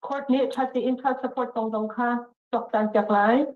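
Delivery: usual speaking voice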